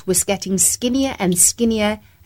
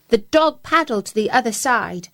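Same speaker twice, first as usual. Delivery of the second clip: usual speaking voice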